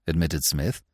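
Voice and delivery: usual speaking voice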